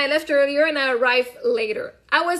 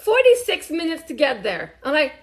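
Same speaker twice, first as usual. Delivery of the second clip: ordinary talk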